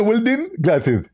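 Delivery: high, lisping caricature; Zakir Naik. Acted. Mock preacher offering the host protection, high and lisping. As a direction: say it in a silly high, lispy preacher voice. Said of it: "Hey, Zakir Naik".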